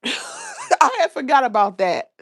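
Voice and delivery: high, loud, slow words through laughter; real laughter. Real, not acted. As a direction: crack up while saying it. Laughs at her forgotten memory.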